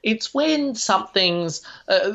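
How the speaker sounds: ordinary talk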